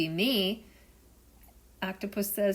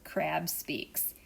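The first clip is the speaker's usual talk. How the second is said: ordinary delivery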